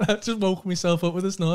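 ordinary delivery

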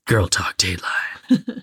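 breathy whispered ad tagline; whispering. Pretend. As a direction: hush it like a commercial. Echoing board game commercial.

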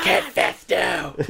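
loud, high, stretched-out mock shout; listener yelling. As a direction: shout the name slowly, like an exasperated fan. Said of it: "someone's listening to this podcast, being like".

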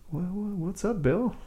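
high, soft, casual mock Jeff Bridges. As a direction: lightly imitate a relaxed Jeff Bridges. Jeff Bridges voiced in story.

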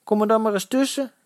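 high tough-guy dare; challenging invitation. A narrator's reading. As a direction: invite him to step in at his peril. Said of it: "inviteerde de potige kameraad".

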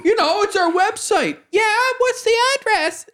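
imitated couple bickering in a climbing pitch. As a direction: push the pitch up as you squabble. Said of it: "this woman's voice is, like, getting higher".

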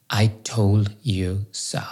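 slow, breathy imagined family whisper; whispered I told you so. Pretend. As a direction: hush it like a voice in your head. Imagined family scolding him.

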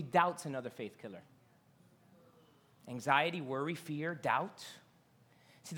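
ordinary talk

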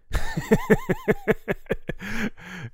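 loud, high laugh breaking out; high burst of laughter. Real, not acted. Comic laughing at his own joke, loud and high. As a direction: crack up at the memory.

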